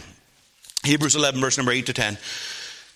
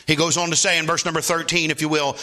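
ordinary delivery

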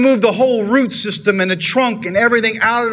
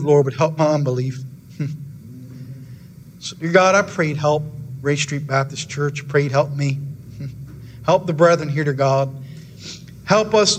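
closing prayer; 3 semitones lower than usual. Acted. Pastor praying over his congregation at close of service, earnest prayer.

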